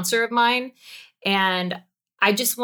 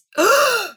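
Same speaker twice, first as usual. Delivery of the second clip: startled gasp; 6 dB louder than usual. Shown as a demonstration. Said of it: "gasping for air".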